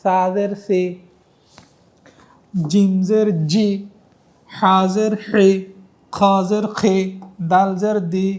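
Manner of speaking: slow, clear letter-by-letter recitation; recitation drill. Shown as a demonstration. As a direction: model each sound for students to repeat. Teacher drills Arabic letter sounds.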